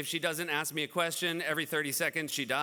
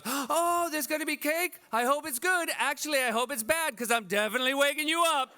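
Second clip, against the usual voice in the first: put-on high, cheeky little-kid voice, much higher, louder at the end; seven-year-old son; 9 semitones higher, 3 dB louder than usual. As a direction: play the sneaky seven-year-old plotting to wake dad.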